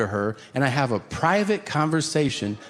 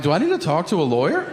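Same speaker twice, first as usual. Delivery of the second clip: nervous rush; 3 semitones higher, much faster than usual. Retold or imitated. Nervous rushed reply.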